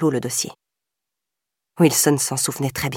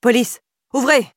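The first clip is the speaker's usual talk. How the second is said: higher, louder police command; shouting; 7 semitones higher, 3 dB louder than usual. A narrator's reading. Wilson shouting at the abandoned house door. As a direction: call through the door with authority.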